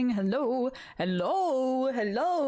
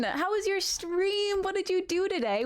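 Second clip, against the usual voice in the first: high, bright welcome; excited greeting; 7 semitones higher than usual. Real, not acted. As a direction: greet the raider with glee.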